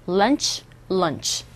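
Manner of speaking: normal talking voice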